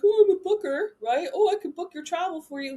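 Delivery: high, bright mock pitch; mock eager booker. Mocking inbox spammers for trainees, high and eager. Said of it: "hop in somebody's inbox and be like".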